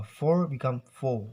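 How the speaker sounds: "for" with r sounded, then "four" as "faw"; R then silent R. Shown as a demonstration. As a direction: contrast "for" with an r against "four" without. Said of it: "silence the R sound".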